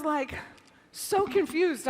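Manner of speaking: ordinary talk, nothing marked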